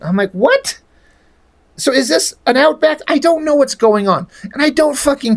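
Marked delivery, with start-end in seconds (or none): 0.3-0.8 high, incredulous rising "what"; baffled disbelief. Retold or imitated. Reader reacting to the villain's speech, baffled disbelief. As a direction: pitch leaps up in mock bewilderment.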